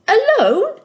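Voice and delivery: high, squeaky cry of fright; cringing in fear. A narrator's reading. Socks, scared to go alone, cringing high and loud. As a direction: shrink back in alarm at being left. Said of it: "Socks cringed".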